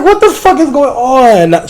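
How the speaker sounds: high, slow, drawn-out outraged squeal; retelling the ACS visits with high, slow disbelief. Retold or imitated. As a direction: stretch it out in shocked disbelief. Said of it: "I'm just like".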